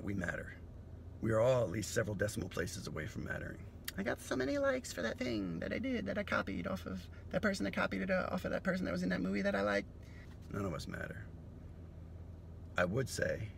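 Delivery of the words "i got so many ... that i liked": much higher, vapid bragging voice; mock influencer. Mocking a like-hungry social media user, high vapid brag. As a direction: brag airily about likes.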